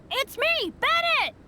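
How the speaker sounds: high, loud call of his own name. Acted. From the cage he calls to the Traveler, loud. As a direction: shout so friends recognize you.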